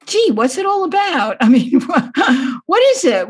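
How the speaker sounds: high, wide-eyed put-on puzzlement; mock naive bafflement. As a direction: play dumb with a chuckle, pitched well up. Mocking her own naive reaction.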